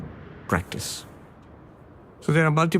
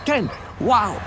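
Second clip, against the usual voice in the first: high, marvelling ten then wow; a bright little gasp of wonder; delighted wow; 10 semitones higher than usual. Retold or imitated. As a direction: savour the discovery. Finds the tenth.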